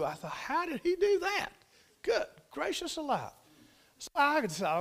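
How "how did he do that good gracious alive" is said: high-pitched amazed outburst; astonished exclamation. Retold or imitated. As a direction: exclaim in disbelief, pitch leaping up. Retold amazement at flying goat.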